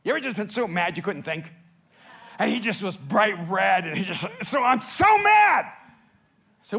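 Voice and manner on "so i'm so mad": high, loud, drawn-out mad outburst; angry son. Retold or imitated. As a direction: shout it like a furious kid, acted out. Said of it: "he was just so angry".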